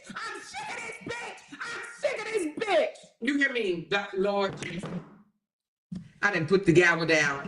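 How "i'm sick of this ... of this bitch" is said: much higher, thin squeaky voice. Pretend. Mocking herself after the judge scene, squeaky high voice. Repeats line in different voices.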